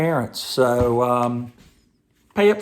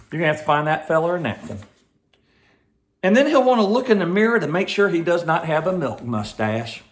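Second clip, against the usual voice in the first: put-on reading with a Southern Georgia accent; 4 semitones higher than usual. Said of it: "read this book like I used to when I, when I used to live in Georgia".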